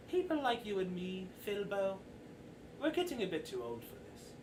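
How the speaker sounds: quiet, confiding ringmaster; weary Mister Light, guard down. Acted. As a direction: let the guard down, talk softly. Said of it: "Mister Light kinda puts down his defenses a little bit".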